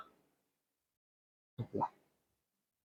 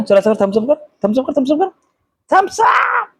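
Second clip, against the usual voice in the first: singsong pep talk ending in a squeal; playful encouragement; 10 semitones higher than usual. Real, not acted. Cheering up upset child.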